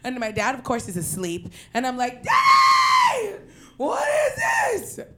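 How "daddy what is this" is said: very high, loud shriek of daddy; screaming. Retold or imitated. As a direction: shriek in shock at the X-ray. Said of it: "I immediately start screaming".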